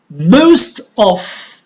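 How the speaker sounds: "most of" with MOST punched out; stress on MOST. Shown as a demonstration. Teacher warning students it is not all, stress on "most". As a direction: lean hard on "most" in "most of". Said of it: "I focus on most of".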